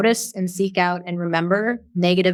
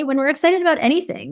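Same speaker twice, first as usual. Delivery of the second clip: ordinary talk, nothing marked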